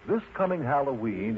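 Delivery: ordinary delivery, nothing marked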